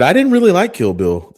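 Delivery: high-pitched loud mock quote; quoting someone. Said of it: "'cause she said".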